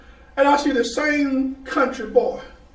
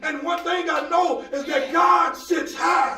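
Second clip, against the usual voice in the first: preaching at full force; 3 semitones higher than usual. Acted. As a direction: drive it home to the church. Preaching climax with congregation responses.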